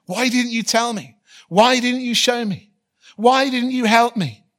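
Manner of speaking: high, loud, pleading cry of blame; anguished outcry. Retold or imitated. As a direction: cry out the blame as if you fell. Voicing fallen person's complaint.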